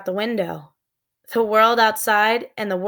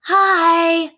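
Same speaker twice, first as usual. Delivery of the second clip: bright loud higher call; shout; 9 semitones higher, 7 dB louder than usual. A narrator's reading. Annie calling down to the pterodon. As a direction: call out to the creature below. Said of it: "Annie shouted".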